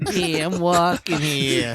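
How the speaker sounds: loud, slow New York yell; New York shout. Pretend. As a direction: bellow it like a cabbie. Mock New York street shout.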